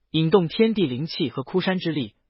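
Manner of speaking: ordinary delivery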